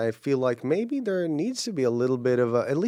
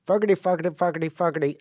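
lightly imitated Irish accent drill; Irish accent warm-up; 4 semitones higher, much faster than usual. Retelling an actor's trick for Irish, imitated accent warm-up. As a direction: chant it to find an Irish accent. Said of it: "an Irish accent, he would tell himself".

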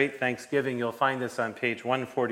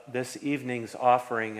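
usual speaking voice

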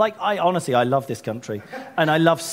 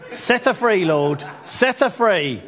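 prayer; 4 semitones higher, slower than usual. Real, not acted. Prays aloud for a woman.

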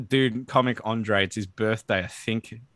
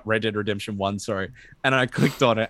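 normal talking voice

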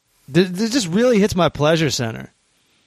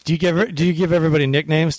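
ordinary talk

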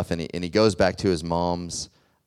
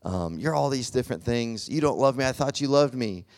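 bitter accusation; 6 semitones higher than usual. Retold or imitated. High son accusing his crying mother, lightly acted. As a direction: lash out like a hurt teenager. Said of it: "cutting her down".

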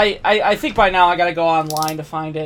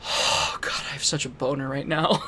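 whispering; 5 semitones lower, 9 dB quieter than usual. Retold or imitated. Acted inner thought, quoted aside.

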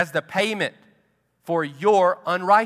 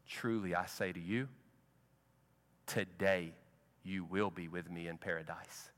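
tender quiet; 10 semitones lower, 18 dB quieter than usual. Retold or imitated. Jesus answers the dying thief.

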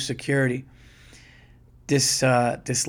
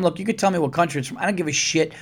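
ordinary talk, nothing marked

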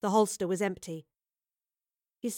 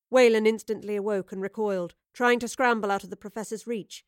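ordinary delivery

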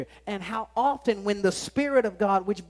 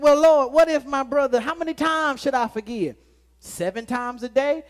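loud, eager question to the Lord; voicing Peter; 4 semitones higher, 7 dB louder than usual. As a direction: ask like a hopeful disciple. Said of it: "Peter brought this up and said".

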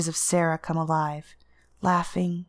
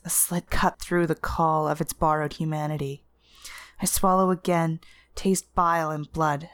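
ordinary delivery

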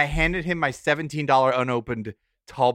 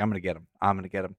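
scheming mutter; 5 semitones lower, 7 dB quieter, faster than usual. Retold or imitated. Plotting revenge on his friend, muttering low and soft. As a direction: lower and softer, plotting under his breath. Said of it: "I was like".

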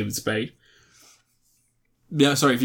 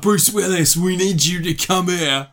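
mock English accent, pitched up; put-on English accent; 4 semitones higher than usual. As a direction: imitate an actor's bad English accent, higher. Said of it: "He puts on an English accent like this".

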